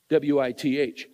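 W I T H said letter by letter; spelled out. Shown as a demonstration. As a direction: spell out W I T H, one letter at a time. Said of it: "You gotta be with".

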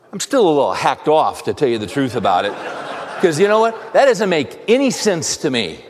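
exasperated rant at a predawn disturbance; rising irritation. Retold or imitated. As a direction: let the annoyance boil over. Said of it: "the energy level went up there as I expressed that".

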